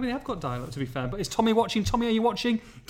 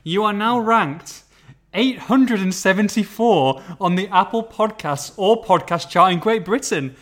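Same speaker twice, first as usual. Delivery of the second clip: slow, emphatic, louder proud reading; mock proud announcement; 3 semitones higher, 6 dB louder, slower than usual. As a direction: savour every word of the ranking. Reading ranking out slowly, proudly.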